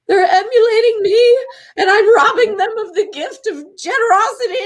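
coming undone. Retold or imitated. Daughter realizes kids emulate her, high laughing sob. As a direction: let it crack between laugh and cry. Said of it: "became undone".

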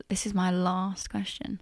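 slow, a bit posh southern "last" with a long a; mock the Queen's English; lightly posh southern imitation. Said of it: "you come out with".

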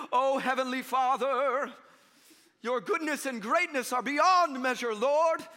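mock-grandiose public prayer, loud, high and drawn out; showy King James prayer. Preacher mimicking people praying in front of everybody, showy prayer voice. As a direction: intone it slowly like a pompous pray-er. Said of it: "They don't talk like that normally".

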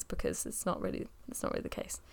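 fading to a breathy whisper; trailing off. Real, not acted. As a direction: voice dropping away to nothing. Aside trailing into near silence.

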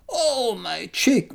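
a mild moan from the struck monk; moaning in pain. Acted. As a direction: groan it out as if struck. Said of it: "moaned Ho-Jong".

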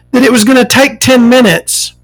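usual speaking voice

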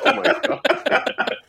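slow, high, stunned gasp of words; drawn-out disbelief; shocked. Retold or imitated. Shocked reaction to prank caller story.

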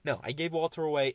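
ordinary delivery

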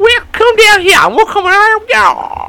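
very high squeaky alien voice; little alien taunting humans, very high. Pretend. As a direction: chirp it like a tiny alien. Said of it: "Do your aliens one".

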